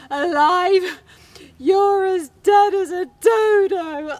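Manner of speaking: high, loud, slow hammed-up sob-speech; wailing widow. Wife insisting her husband is dead, loud high wailing. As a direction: wail through mock tears. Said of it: "she carried on crying".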